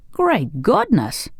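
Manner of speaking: high, drawn-out exclamation; shocked cry. A narrator's reading. Tin Woodman hearing of the revolt, shocked cry. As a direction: exclaim it slowly in high dismay. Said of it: "cried the Tin Woodman".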